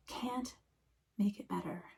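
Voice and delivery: ordinary delivery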